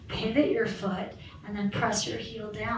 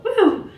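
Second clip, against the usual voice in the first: high, loud laughing woo; excited whoop; 9 semitones higher, 11 dB louder than usual. Real, not acted. Teacher cheers after the affirmation, laughing whoop. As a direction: let out a joyful whoop with a laugh. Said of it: "I got really too excited on that one".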